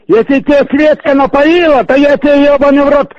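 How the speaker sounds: ordinary delivery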